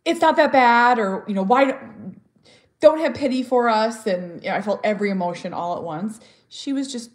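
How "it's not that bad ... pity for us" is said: loud high exasperated protest; inner outburst. Retold or imitated. Mother reacting to pity about her son, loud protest. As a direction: burst out, it's not that bad.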